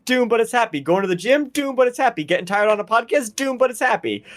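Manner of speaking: high-pitched infomercial patter; mock advert pitch. Selling co-hosts a playlist for tiredness, mock advert pitch. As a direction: sell it like an eager TV pitchman.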